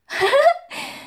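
high scornful laugh; 轻蔑的笑声; mocking laugh. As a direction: laugh at him with contempt.

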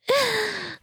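high teasing laugh; a sly, scornful little laugh; mocking giggle. Mocking laugh after taunt. (轻蔑的嗤笑)

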